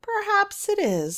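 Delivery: high, loud amused witch voice; smiling. A narrator's reading. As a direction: smile through it, high and bright. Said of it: "smiled the witch".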